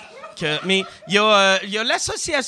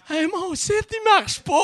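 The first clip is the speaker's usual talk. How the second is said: whiny customer; 13 semitones higher than usual. Retold or imitated. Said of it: "t'es comme".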